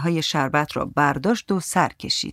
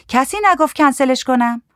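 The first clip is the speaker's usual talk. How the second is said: high, raised voice answering back; high defensive woman; 8 semitones higher, 5 dB louder than usual. A narrator's reading. Woman defending herself over the cancellation, high-pitched retort.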